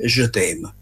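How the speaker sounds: je t'aime said in careful French, zhuh TEM; French phrase. Shown as a demonstration. Viewer tries saying love in French, careful French je t'aime. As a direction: try the French je t'aime with care. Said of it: "se la mia pronuncia è corretta".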